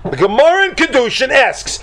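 a hint of the Rosh losing patience; mock exasperation. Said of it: "The Rosh says".

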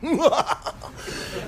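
mock evil laugh. Devil's laugh after the threat.

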